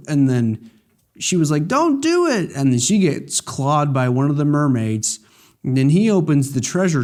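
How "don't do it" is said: very high, squeaky plea; hammed-up high cry of don't; mock plea. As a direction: squeal the warning. Voicing a pleading woman.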